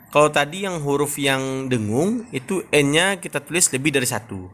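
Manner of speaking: ordinary talk, nothing marked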